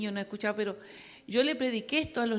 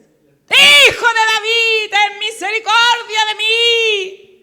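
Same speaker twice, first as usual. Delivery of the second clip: high, stretched full-force shout; even stronger outcry; 11 semitones higher, 19 dB louder, much slower than usual. Acted. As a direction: bellow for mercy as loud as you can. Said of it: "pero él clamaba mucho más".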